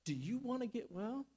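soft, gentle-voiced question; quiet, close-up question; Jesus leaning down. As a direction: ask it quietly, as if leaning down to someone. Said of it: "he leans down to this man and he says".

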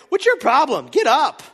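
loud, high scolding; mock harsh parent. Parent to a sick kid, mock-scolding. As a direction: play an impatient parent ordering a child up.

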